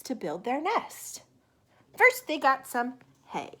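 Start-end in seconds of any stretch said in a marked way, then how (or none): none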